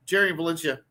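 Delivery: ordinary talk, nothing marked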